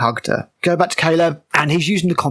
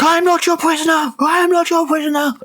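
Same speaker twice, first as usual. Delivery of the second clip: high-pitched strained angry cry; mock angry scream; 12 semitones higher than usual. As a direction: imitate an angry prisoner, pitched way up. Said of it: "he screams and gets angry".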